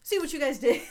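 loud, high mock outburst; pissed off. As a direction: imitate an angry man blaming the others. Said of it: "he gets pissed at them".

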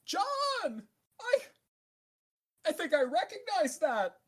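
high, loud warbling call through water; shouting underwater. Pretend. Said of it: "I kinda wanted to do the underwater voice".

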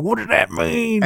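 high, slow put-on voice; mock appalled character. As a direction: draw the question out in a high pitch. Acting the appalled character's question.

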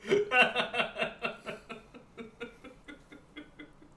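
high, loud burst of laughter. Real, not acted. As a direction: crack up at the joke. Big laugh at joke.

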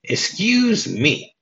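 high, heavily drawn-out mock "excuse me"; imitating Violet's call. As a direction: stretch it out high, playing Violet getting men's attention. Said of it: "turns to these two guys ... and goes".

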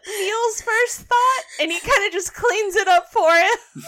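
high, breaking up with laughter; laughing. Real, not acted. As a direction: talk through helpless laughter. Player cracking up mid-sentence.

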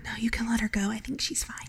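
soft unvoiced whisper. Real, not acted. Player whispers back to Elaine's question, whispering.

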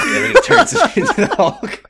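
high, loud laughing punchline. Real, not acted. Podcaster cracking up at his own Hulk joke. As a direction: laugh the words out.

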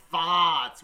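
"farts" with the r dropped, loud Boston; full Boston accent on "farts". Retold or imitated. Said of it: "hits the full sting on".

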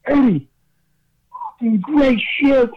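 excited praise. Retold or imitated. Bruce quoted praising him backstage.

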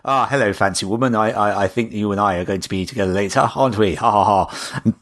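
put-on terrible James Bond accent. Mocking the spy to a friend, a faint Scottish Bond accent. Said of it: "Terrible James Bond accent".

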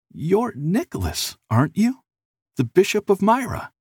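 high, puzzled questioning; puzzled and tentative; confused. A narrator's reading.

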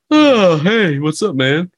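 high, loud opening easing into a casual greeting; mock casual. Imitating Herman peeking his head out, exaggeratedly casual. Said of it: "leaning against all casual".

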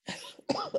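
a real, rough chesty cough. Real, not acted. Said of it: "so sorry about coughing".

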